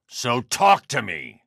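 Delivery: high, slow, pressing yell; drawn-out interrogation shout. Acted. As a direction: lean in and force him to answer. Interrogating a Riddler henchman.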